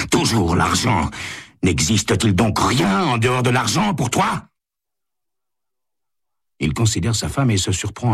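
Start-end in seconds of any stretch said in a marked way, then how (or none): 0.1-4.5 anger climbing in pitch; angry. A narrator's reading. Husband reproaches his wife about money, pitch rising in anger. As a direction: build to a high, indignant question. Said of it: "dit-il avec colère".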